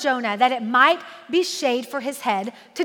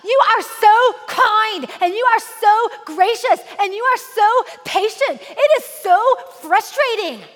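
whiny sulking Jonah; 5 semitones higher than usual. Retold or imitated. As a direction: sulk at God like an annoyed kid. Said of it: "This is literally how I feel like he's talking to God".

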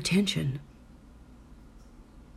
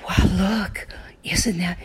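sharp gasp then awed oh wow; quick amazed exclamation trailing into a whisper; mother showing her child the sunlight, amazed; wonder; 3 semitones higher, much slower than usual. Shown as a demonstration. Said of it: "the wonder that I expressed".

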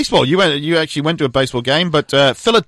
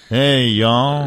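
southern accent; 3 semitones lower than usual. Shown as a demonstration. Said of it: "a little bit like that".

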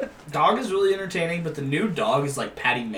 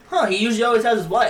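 higher, quick puzzled remark; lightly acted surprise, pitched up; retold puzzled reaction; 6 semitones higher, 4 dB louder, faster than usual. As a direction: act out noticing something odd. Retelling own surprised reaction.

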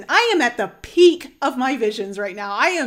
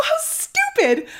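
tearful exclaim; slower than usual. Retold or imitated. Crying with emotion.

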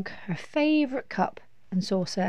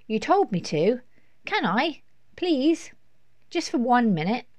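put-on high, wheedling little boy; pleading child; 6 semitones higher, 4 dB louder than usual. A narrator's reading. Lester pleads with Mum for one minute, high wheedling. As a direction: beg in short, high bursts.

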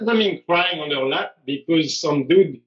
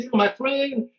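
lightly acted coach, a bit higher; friendly Brazilian coach; 6 semitones higher, slower than usual. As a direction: reassure like a chummy Brazilian coach. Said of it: "'Cause he's Brazilian".

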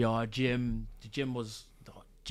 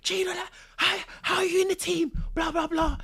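lively, much higher retelling of the lads' questions; a light mock of the curious teammates, pitched up; excited; 4 dB louder than usual. Retelling lads asking how he got in, in a lightly high voice.

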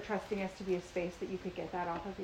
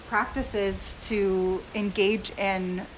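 ordinary delivery

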